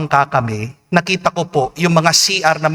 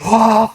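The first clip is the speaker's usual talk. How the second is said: higher, acted gasp on falling in; imitated gasp; 6 semitones higher than usual. Priest retells falling into the water, acted gasp. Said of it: "sabi ko".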